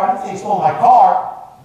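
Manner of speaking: "car" stressed at the very end. Shown as a demonstration. Coach ending the seven-meanings demonstration, stress on "car". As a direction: save the emphasis for the final "car". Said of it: "say this sentence a few times".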